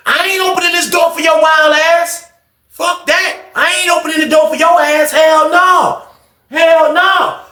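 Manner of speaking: very high-pitched yelling; hammed-up outraged refusal; acted shouted refusal. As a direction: yell it from behind a locked door. Acted refusal from behind door.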